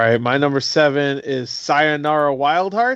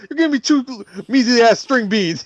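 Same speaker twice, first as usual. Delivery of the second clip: [name] quote; 6 semitones higher than usual. Retold or imitated. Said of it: "He's like".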